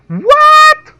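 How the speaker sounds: very high, loud screech of disbelief; shocked outburst. Acted. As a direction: yell it high in mock shock. Mock shock at battle damage.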